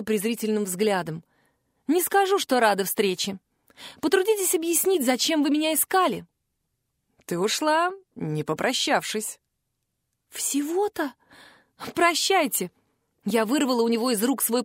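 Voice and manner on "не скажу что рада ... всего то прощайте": defiant. A narrator's reading. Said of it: "с вызовом задрала подбородок".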